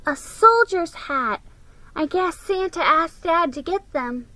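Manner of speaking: proud of his soldier hat; lightly voiced small boy; little boy. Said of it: "he said".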